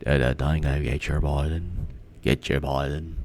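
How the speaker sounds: very soft, low Australian try; hushed amateur Australian accent; attempted Australian accent. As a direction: practise an Australian accent to yourself. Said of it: "I'm trying to do the Australian".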